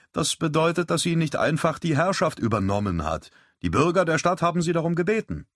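put-on patient mate setting a boy straight; Wyatt explaining. A narrator's reading. Wyatt corrects the young lad at cards, lightly voiced and patient.

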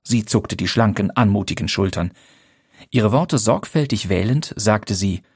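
usual speaking voice

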